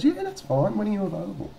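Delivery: high-pitched, loud woman impression; agreeable policewoman. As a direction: imitate her agreeing readily on the phone. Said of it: "she goes".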